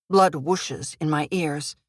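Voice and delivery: ordinary delivery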